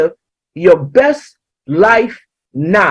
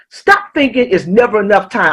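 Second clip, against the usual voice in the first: normal talking voice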